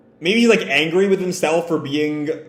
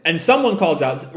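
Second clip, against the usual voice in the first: ordinary delivery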